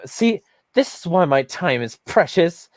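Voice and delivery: high-pitched imitation of indignation; mock indignant. Retelling an opponent brushing off the funding question, mock indignant. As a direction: mimic someone huffily put out. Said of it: "waxes indignant while I say that".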